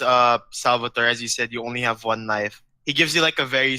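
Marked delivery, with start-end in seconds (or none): none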